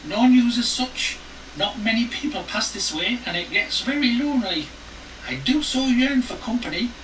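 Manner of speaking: a needy old lady, voiced up high; high old woman voice.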